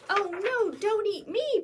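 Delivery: high, loud pleading goat; begging goat. A narrator's reading. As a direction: beg for mercy. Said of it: "begged the second goat".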